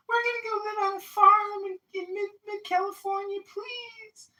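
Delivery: put-on high, sing-song voice of a young couple asking nicely; sweet couple.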